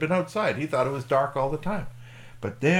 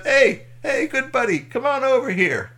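high, loud friendly holler; boisterous camper's welcome; hearty calling out; 10 dB louder than usual. Acted. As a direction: call a buddy over from the campfire. Clem calls the alien over.

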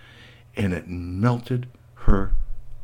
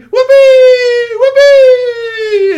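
put-on screaming kids; 12 dB louder, much slower than usual. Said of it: "the kids were screaming".

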